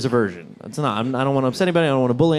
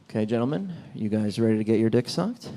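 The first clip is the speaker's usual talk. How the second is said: mock seductive murmur; 7 semitones lower, 6 dB quieter than usual. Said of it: "and I'll be like".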